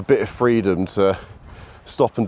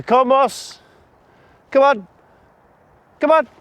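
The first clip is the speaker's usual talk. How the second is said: 1 calling the dog; 4 dB louder than usual. Real, not acted. Calling dog on long line.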